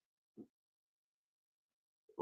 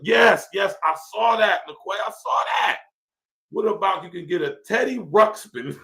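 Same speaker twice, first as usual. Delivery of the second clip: excited; 8 semitones higher than usual. Real, not acted. Excited reply to chat.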